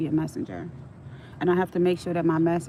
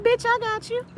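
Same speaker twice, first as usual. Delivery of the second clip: high, playful reassurance; reassuring friend. Retold or imitated. Telling her tearful supervisor she's got her, high and playful. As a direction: promise her you've got her back. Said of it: "I'm like".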